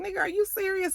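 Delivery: high, disbelieving question; incredulous. Retold or imitated. Councilman Tate offered a watch for a bribe, incredulous.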